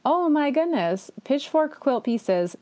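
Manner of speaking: ordinary talk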